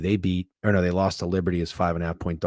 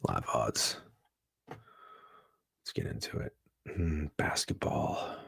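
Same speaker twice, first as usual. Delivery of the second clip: hushed whisper while browsing odds; soft unvoiced musing; 5 semitones lower, 10 dB quieter, slower than usual. Real, not acted. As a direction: whisper it like nobody should hear. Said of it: "I don't know why I'm whispering this whole time".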